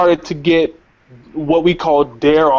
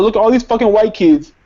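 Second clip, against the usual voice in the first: higher, indignant retold outburst; pointing out the unfairness, worked up; heated protest; 6 semitones higher than usual. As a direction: replay the outburst with rising indignation. That's what I was thinking.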